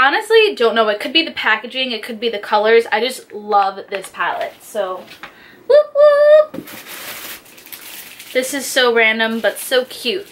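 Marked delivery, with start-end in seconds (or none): 5.7-6.7 loud, very high double whoop; celebratory whoop. Real, not acted. Celebrating the palette.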